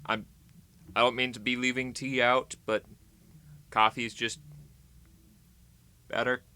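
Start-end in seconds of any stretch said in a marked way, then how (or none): none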